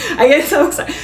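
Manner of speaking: excited laughing. Real, not acted. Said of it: "see how I get excited".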